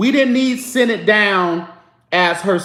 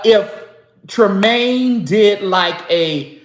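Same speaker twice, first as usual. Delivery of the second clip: ordinary talk, nothing marked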